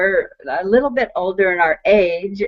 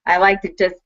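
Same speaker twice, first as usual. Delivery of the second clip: usual speaking voice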